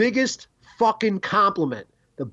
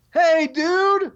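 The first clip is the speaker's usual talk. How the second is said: doorman greeting; 14 semitones higher, 3 dB louder than usual. Retold or imitated. Imitating the blues club doorman.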